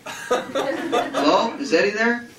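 put-on high, far-off girlish voice; dreamy girl. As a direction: sound like a girl in a trance. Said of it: "voice of a girl in a trance".

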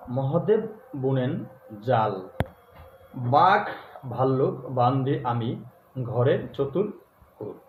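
slow mantra chant with long pauses between words; measured mantra recital, word by word; very slow recitation. Shown as a demonstration. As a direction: dictate it slowly so they can write it down. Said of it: "এত ধীরে ধীরে বলবো আপনাদের ডায়েরিতে লিখতে".

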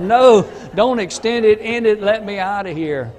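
high, loud mock-panicked outburst; retold desperate protest. Retold panicked plea to end invitation.

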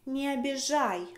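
ordinary talk

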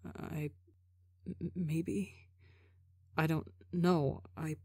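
very soft, halting, unsure murmur; mumbling. A narrator's reading. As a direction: mumble hesitantly, barely audible.

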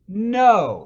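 a sharp, loud imitated no; retold clerk's loud no; flat loud no. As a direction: slam the door with one word. Clerk's final refusal in story.

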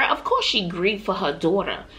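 ordinary talk, nothing marked

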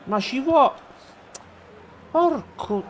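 loud, high exasperated outburst; frustrated shouting. Real, not acted. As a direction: shout it out, biting back a curse. Frustrated player nearly swears.

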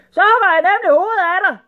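high, fast, loud tyrant voice; angry threat. A narrator's reading. Sultan threatening the rat's head, angry shouting. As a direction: rap out the threat at full volume.

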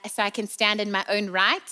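usual speaking voice

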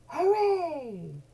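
joyful loud hooray; cheering hooray. Acted. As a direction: cheer like the whole plane. Passengers cheer.

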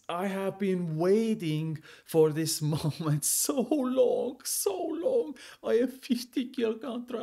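high, ecstatic cheering over a rare drop; thrilled gamer yelling at the loot. Real, not acted. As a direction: scream with pure joy, way up high. Reaction clip, ecstatic loot drop.

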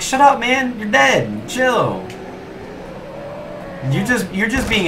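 high-pitched mock complaint; high, fed-up protest without shouting; exasperated telling-off. Snapping at zombies yelling in ear.

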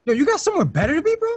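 fast, loud, high-pitched comeback; heated rapid-fire challenge; confrontational. Acted. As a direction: snap back at a rival. Snapping back in a quarrel.

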